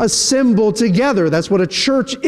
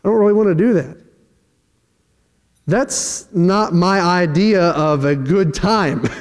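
reluctant. Retold or imitated. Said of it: "their reaction might be similar to my reaction".

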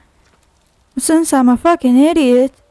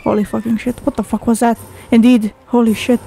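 normal talking voice

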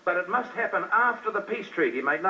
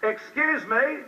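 raised, higher interrupting call; retelling how he called out from the audience, higher and raised; retold shout; 6 semitones higher, much slower than usual. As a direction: cut into his speech from the back of the hall. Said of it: "I stood up and I shouted".

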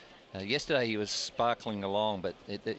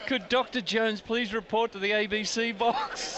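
slow mock tannoy announcement; 10 semitones higher, 3 dB louder than usual. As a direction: page a doctor like an announcer. Mock public address call.

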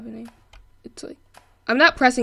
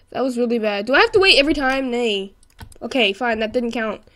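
normal talking voice

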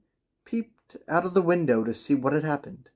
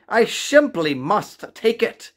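slow, higher, longing cry; eager cry; 7 semitones higher, 4 dB louder, slower than usual. A narrator's reading. Old man cannot leave the striped kitten, crying out slowly. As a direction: draw it out, unable to resist. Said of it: "cried the very old man".

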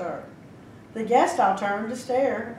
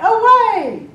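high, loud command; urgent cry; 12 semitones higher, 10 dB louder than usual. A narrator's reading. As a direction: shout it to the coachman. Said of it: "reached her coach and cried".

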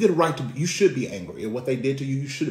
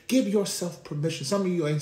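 higher repeat, preached out; preaching at full force; 7 semitones higher than usual. Acted. Preacher repeating the point to the church, raised up. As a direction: hit it again, lifted.

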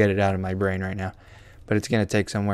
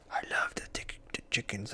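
whispering; 15 dB quieter than usual. Real, not acted. Whispered slip.

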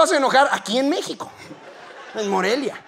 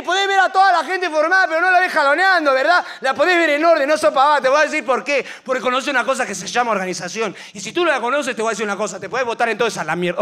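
angry lecture; 4 semitones higher than usual. Pretend. Pope lecturing the woman, Argentine.

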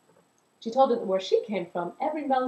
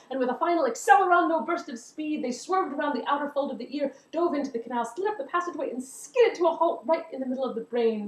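excited narration; 6 semitones higher than usual. A narrator's reading. Breathless climax of the chase.

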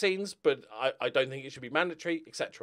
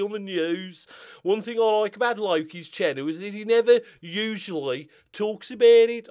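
a light put-on Birmingham voice, higher; mock Birmingham accent; 5 semitones higher, 4 dB louder than usual. Shown as a demonstration. YouTuber reading a viewer's comment in a mock Birmingham accent. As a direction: read the comment in a rough Brummie voice. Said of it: "I was gonna do an accent".

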